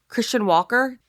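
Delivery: usual speaking voice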